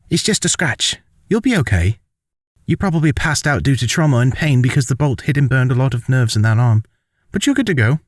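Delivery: high-pitched nurse character voice; nurse Sid, reassuring. Acted. Assistant nurse checks a patient's wound, high reassuring voice.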